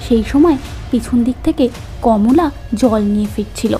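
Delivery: plain reading voice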